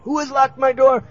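high-pitched cry for help; calling out. Acted. People locked in call out to whoever did it, calling out. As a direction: call out through a locked door. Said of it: "they would be calling out".

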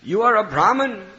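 loud, high mock warning; teasing. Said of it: "they were teasing Nimai".

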